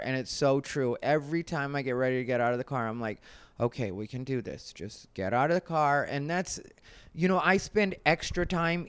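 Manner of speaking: ordinary talk, nothing marked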